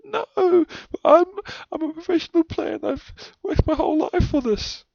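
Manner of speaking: high, wailing plea, half sobbing; crying protest. Retold or imitated. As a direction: beg like a crushed kid about to cry. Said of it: "And I'm like".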